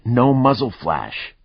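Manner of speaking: plain reading voice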